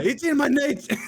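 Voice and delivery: high, loud character voice; high put-on voice. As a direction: repeat it in a squeaky silly voice. Repeats it in a put-on voice.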